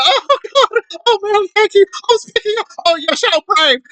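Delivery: very high, breathy mock gushing; mock gushing women. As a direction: overdo the swooning gratitude.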